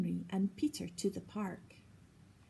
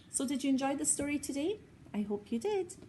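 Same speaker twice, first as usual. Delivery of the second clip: put-on reading to children; 5 semitones higher, much faster than usual. Talks to child listeners.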